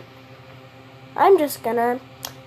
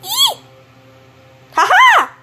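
squeal; 7 dB louder than usual. Real, not acted. Finds iron.

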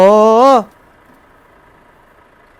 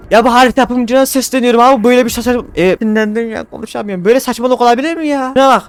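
loud, high ranting at the map maker; angry complaint; 5 semitones higher, 3 dB louder than usual. Real, not acted. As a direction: rant at the developer. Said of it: "Böyle sinirlenince". (harita yapımcısına öfkeyle sitem)

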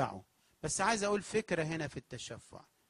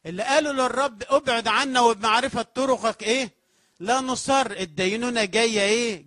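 louder, higher fiery sermon voice; preaching at full force; 6 semitones higher, 10 dB louder than usual. Acted. Preacher warning the turned-away elders of judgment, fiery preaching. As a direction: thunder the warning at the congregation.

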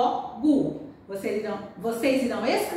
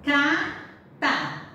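cata drawn out slowly, ca-ta split into syllables; 2 semitones higher than usual. Shown as a demonstration. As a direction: sound out cata slowly for the class. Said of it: "A outra".

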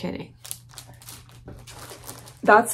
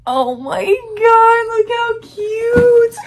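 much higher, louder and slow, gushing; excited squeal; 13 semitones higher, 6 dB louder, much slower than usual. Real, not acted. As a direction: squeal it out over something adorable. Seeing the baby play mat.

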